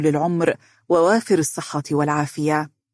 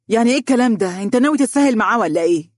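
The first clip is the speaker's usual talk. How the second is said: higher-pitched indignant challenge, speeding up; angry; 6 semitones higher, 4 dB louder, much faster than usual. A narrator's reading. Wife challenging her husband over the suitor, angry. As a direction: confront him in rising annoyance. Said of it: "قالت بغضب".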